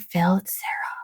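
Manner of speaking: hushed secretive whisper. Retold or imitated. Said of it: "whispered secret phone call".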